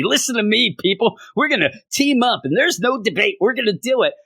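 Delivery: a hint of a movie president rallying troops; rallying speech. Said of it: "inspire everybody".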